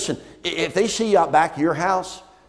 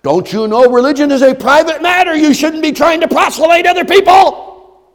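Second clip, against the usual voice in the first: high, loud scolding shout; scolding critic; 14 dB louder than usual. Pretend. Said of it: "they tell you".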